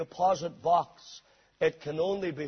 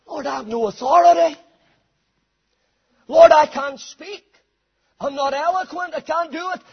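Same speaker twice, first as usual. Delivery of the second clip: high, loud excuses piling up; insistent acted protest to God; Moses, protesting; 10 semitones higher, 11 dB louder than usual. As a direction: plead your way out, raised voice.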